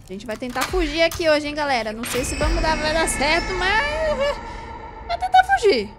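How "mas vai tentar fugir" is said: squeaky high voice. Real, not acted. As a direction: jump way up into a squeak. (voz fininha e aguda)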